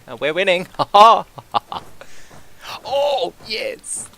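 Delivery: loud, high, giddy cheer breaking into laughter; can't contain the glee, cheer and cackle; overexcited cheering. Real, not acted. Streamer cheering his winning war to viewers, loud and high with laughter. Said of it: "Sorry, I'm getting far too excited".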